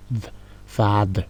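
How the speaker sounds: "father" said slowly with a soft voiced "th"; demonstrated th. Shown as a demonstration. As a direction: say "father" slowly, tongue between the teeth. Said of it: "on va dire father". ("father", le th prononcé lentement)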